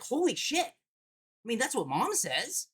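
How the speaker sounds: put-on a child blurting the grown-up word; mock little girl, proudly innocent; imitating his daughter. Daughter quoted in a story.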